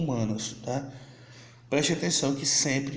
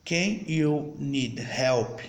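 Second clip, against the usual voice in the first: whole question said slowly in one go; slow full demo sentence; much slower than usual. Shown as a demonstration. Teacher to students, slow but connected English question. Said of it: "olha como eu falei diferente".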